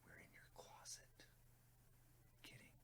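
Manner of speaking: mock-sinister hushed whisper; creepy whisper. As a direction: whisper it like a lurking stalker, joking. Creepy joke to the viewers.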